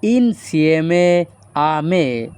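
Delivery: very slow, drawn-out syllables; slow Italian model. Shown as a demonstration. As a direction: model the sentence slowly for students. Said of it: "এই বাক্যের Italian ভাষা হবে". (ধীরে ধীরে Italian উচ্চারণ)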